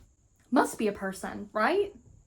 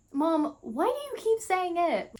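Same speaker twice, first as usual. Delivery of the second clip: high, slow, drawn-out whine; acted whining daughter; 7 semitones higher than usual. Daughter pressing Mom for an answer, acted drawn-out whine. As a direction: stretch the question out high at Mom.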